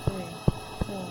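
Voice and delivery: quick soft recount; counting under breath. Real, not acted. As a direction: mutter the count again, carefully. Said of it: "I'm counting wrong".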